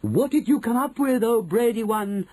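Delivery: high, loud eager chorus; calling out. A narrator's reading. Witches questioning the brainy one, calling out. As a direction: call to your leader at high pitch. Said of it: "they called out".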